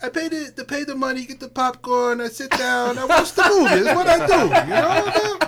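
high, laughing mock Italian voice; mock Italian accent. Friends joke she sounds Italian, so he hams a mock Italian accent. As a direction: ham up a cartoon Italian moviegoer. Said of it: "Or Italian".

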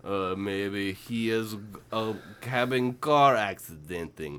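slow, low Russian mother; fake Russian accent. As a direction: muse slowly as a Russian mom. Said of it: "And Bailey's mom was like".

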